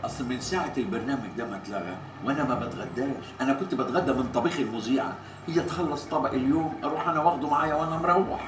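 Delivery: low, soft, fast confiding; quick sheepish explanation. Acted. Man admits he took his lunches from the presenter's show, low quick explanation.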